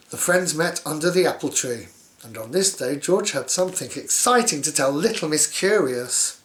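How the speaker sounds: plain reading voice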